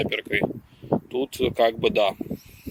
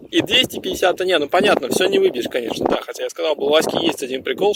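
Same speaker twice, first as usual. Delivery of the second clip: ordinary talk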